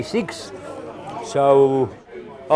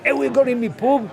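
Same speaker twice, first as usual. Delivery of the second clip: higher-pitched mock landlord; angry landlord; 8 semitones higher than usual. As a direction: play the suspicious landlord. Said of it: "he said".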